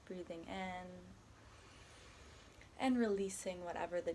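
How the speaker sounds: calm, unhurried body scan guidance with long pauses; guided meditation. Acted. Guiding a listener through a body scan, soft meditation voice. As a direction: ease the listener gently from one body part to the next.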